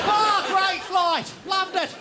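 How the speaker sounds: high, slow, wobbly mock cheer; dazed passenger. Passenger staggering off a long flight, high mock cheer. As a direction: sound wrecked but chirpy.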